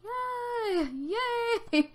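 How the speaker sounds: a high, crying little yay, half-hearted; tearful mock yay. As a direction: cheer weakly while close to tears. Mock cheer on a sad birthday.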